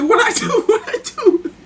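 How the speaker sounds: very high, laughing protest of innocence; mock innocence, laughing. V answering that he ruins everything, laughing mock innocence. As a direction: squeal it innocently through laughter.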